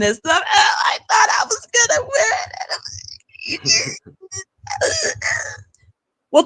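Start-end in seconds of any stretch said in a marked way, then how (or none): 0.5-5.4 very high, whiny fake sobbing; hammed-up tearful squeal collapsing into wordless sobs; mock crying. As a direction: wail like a sore loser, badly faked. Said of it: "I would love to see her crying".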